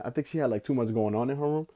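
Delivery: ordinary talk, nothing marked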